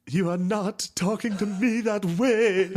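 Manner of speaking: high, slow, haughty mock voice; prim Victorian aristocrat lady, slowed down; mincing high-pitched indignation; mocking high affected voice.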